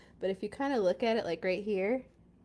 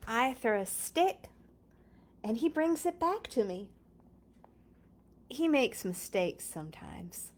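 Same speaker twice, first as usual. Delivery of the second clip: lively storybook read-aloud; reading to children. Acted. Reading Clifford aloud to viewers, bright storybook voice. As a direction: read it like story time for little kids. Reads picture book aloud.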